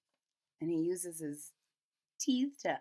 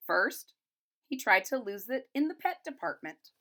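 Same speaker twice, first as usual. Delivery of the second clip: brighter storybook read, a bit higher; reading to children; 3 semitones higher, 3 dB louder than usual. A narrator's reading. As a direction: read it aloud like a picture book for kids. Reads picture book aloud.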